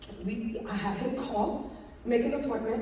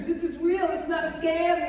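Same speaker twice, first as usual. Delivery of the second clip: louder, higher retold insistence; insistent plea; 5 semitones higher, 5 dB louder than usual. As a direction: plead your case to a parent, acted out. Retold plea to her father.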